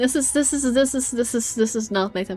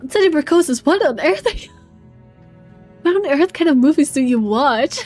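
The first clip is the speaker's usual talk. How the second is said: incredulous outburst; 4 semitones higher, 5 dB louder than usual. Real, not acted. Incredulous outburst at viewer's tastes.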